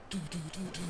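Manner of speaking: quick run of doo-doo noises; imitated keyboard smashing. Streamer picturing Cookie in voice chat, imitated keyboard smashing. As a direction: mimic keys being smashed with short doos. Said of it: "Smashing keyboards and stuff".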